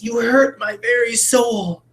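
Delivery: high slow wounded hamster squeak; a hurt little hamster, drawn out. Retold or imitated. Teacher voicing the hamster, high and slow. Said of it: "like that hamster before".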